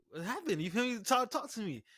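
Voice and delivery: high urgent questions; excited. Retold or imitated. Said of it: "I'm like".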